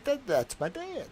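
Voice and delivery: a lightly voiced daughter, high and slow; daughter's reply. Daughter quoted pointing at photo.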